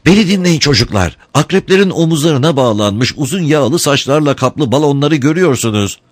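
high-pitched rallying call to the gang; crying out. A narrator's reading. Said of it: "Flora haykırdı".

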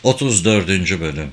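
ordinary delivery

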